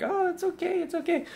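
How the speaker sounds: high, soft mock-mother cooing; mocking soothing mom. Son imitating his nurturing mom, high mock coo. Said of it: "She's like".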